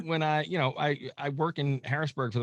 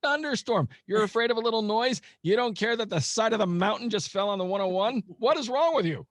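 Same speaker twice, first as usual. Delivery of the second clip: high-pitched, exasperated mock outrage; incredulous scolding; 11 semitones higher, 3 dB louder than usual. Mocking Californians scared of a thunderstorm, in high-pitched incredulous exasperation. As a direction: scold them in a much higher voice, appalled.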